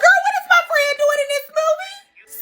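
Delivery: high, squealing disbelief with a laugh; mock shocked squeal. Kid recognizing Jack Skellington on screen, squealing. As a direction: squeal like a kid spotting a favorite character. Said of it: "I said".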